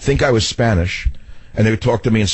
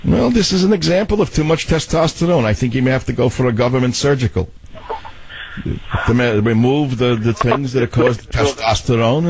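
put-on doctor voice, higher than the host's own; Doctor and Savage character; 4 semitones higher than usual. Doctor prescribing government surgery, in a put-on comic voice. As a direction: play a mock doctor giving his diagnosis. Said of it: "I gave you a new Doctor and Savage voice".

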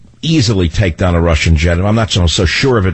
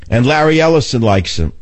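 ordinary talk, nothing marked

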